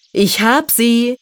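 high, stretched-out calling; triumphant cry. A narrator's reading. Forest elf cries from the tree with shoes, triumphant. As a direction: call it out slowly, high and triumphant. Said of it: "schrie er aus vollem Halse".

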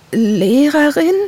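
high, drawn-out startled question; flustered. A narrator's reading. Gerda learning the neighbour is a teacher, flustered. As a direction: stretch it slowly upward in embarrassed surprise. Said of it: "stotterte Gerda und wurde dabei rot".

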